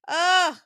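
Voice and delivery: loud frustrated "ugh" groan; exasperated groan. Real, not acted. Friend groans at the Guinness rule, exasperated.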